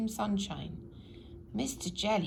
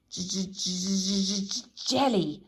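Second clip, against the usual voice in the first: nervous stammer; 5 dB louder than usual. A narrator's reading. Mister Jelly stammers his name to the tramp. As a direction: trip over your own name in fear. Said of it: "stammered Mister Jelly".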